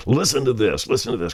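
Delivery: reliving the thrill of a find; excited retelling. Retold or imitated. DJ calling receptionist and traffic director to hear it, excited. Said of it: "and said".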